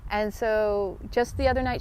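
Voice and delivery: normal talking voice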